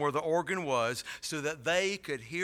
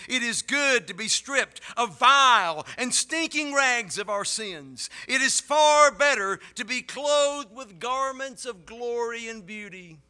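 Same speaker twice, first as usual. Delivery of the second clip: passionate preaching; 7 semitones higher, 6 dB louder than usual. Acted. Preaching build.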